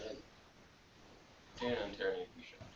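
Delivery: ordinary delivery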